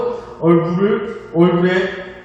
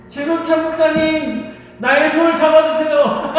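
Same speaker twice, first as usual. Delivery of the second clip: desperate call; 9 semitones higher, 4 dB louder than usual. Retold or imitated. Eyes shut, begging the pastor to take his hand. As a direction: cry out for someone to grab your hand. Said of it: "하면서 막 불렀어요".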